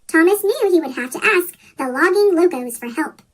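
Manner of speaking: ordinary delivery, nothing marked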